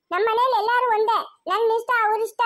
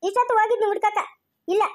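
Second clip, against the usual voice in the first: ordinary talk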